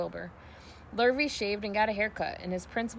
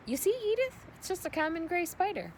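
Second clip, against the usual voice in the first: a hint of a dismissive farmer, pitched up; brushing it off to his wife, higher; lightly voiced Zuckerman; 10 semitones higher than usual. A narrator's reading. Zuckerman shows wife spider.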